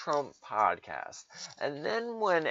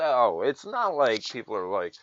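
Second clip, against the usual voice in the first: usual speaking voice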